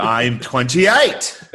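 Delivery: loud, drawn-out mock declaration; ridiculous announcement. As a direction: stretch it slowly and blurt it out loud. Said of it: "it sounds so ridiculous".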